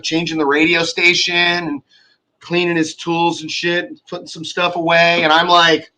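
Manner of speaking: normal talking voice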